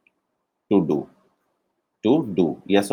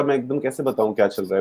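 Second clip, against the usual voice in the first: ordinary delivery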